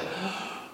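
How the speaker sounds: quick acted gasp of dread; alarmed gasp. Preacher acting out someone carrying guilt, alarmed gasp. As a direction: gasp as if caught out.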